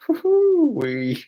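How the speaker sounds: high, slow woo-hoo; relieved whoop. Real, not acted. Nearly read card out.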